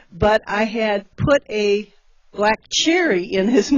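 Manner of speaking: usual speaking voice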